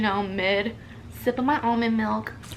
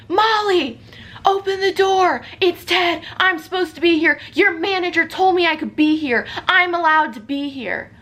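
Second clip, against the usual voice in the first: higher, louder call through a door; mock shouting Ted; 7 semitones higher, 3 dB louder than usual. Ted shouting through the door at Molly, mock insistent call. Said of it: "Ted shouting through the door".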